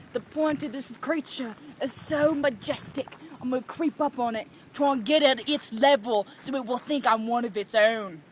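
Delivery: soft, hushed wildlife narrator; nature documentary. Pretend. Documentary narration about the goose.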